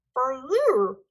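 mildly happy offering. A narrator's reading. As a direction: offer it with a touch of glee. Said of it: "laughing happily".

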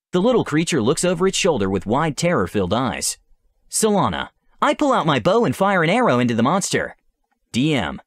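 ordinary talk

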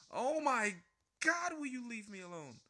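exasperated outburst. Real, not acted. Exasperated at pursuer in game.

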